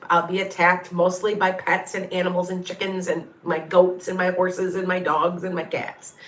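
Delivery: usual speaking voice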